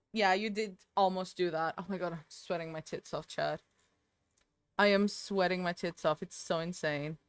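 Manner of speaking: normal talking voice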